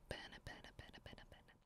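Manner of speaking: soft whispered bene, bene, each bene breathed out; a faint teasing whisper of bene, bene. Acted. Mistress savouring her words.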